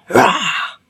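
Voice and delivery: mock lion roar; lion's roar. Ogre turning into a lion before Puss, roaring.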